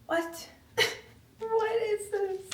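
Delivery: high, slow baffled question; genuinely puzzled and amused; surprised. Real, not acted. Puzzled by a packet of powder.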